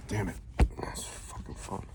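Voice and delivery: annoyed whisper under breath; whispered cursing. Real, not acted. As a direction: hiss a curse at the phone. Fumbling with phone, swearing.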